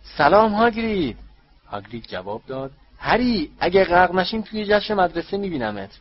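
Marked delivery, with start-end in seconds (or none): 0.0-1.2 high, loud shout of greeting. A narrator's reading. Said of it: "فریاد زد". 3.0-5.9 high, loud shout over the rain; calling out. A narrator's reading. Calling back through the rain.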